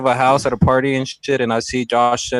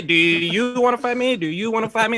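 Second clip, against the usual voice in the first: mock tough guy; 7 semitones higher than usual. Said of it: "He's a clown".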